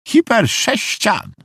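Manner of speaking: very high, slow, rising announcement; high, stretched-out character voice; eccentric visiting lady. A narrator's reading. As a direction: pronounce it grandly in a high guest's voice. Odd guest announces a strange word.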